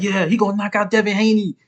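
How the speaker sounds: mocking an overconfident fan; mock hyped fan. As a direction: ham up a cocky fan calling the knockout. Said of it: "who everybody was like".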